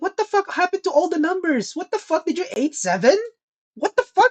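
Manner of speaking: high-pitched mock disbelief; hammed-up, squeaky exasperation; incredulous outrage. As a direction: demand an explanation, voice way up. Acted outraged reaction to her.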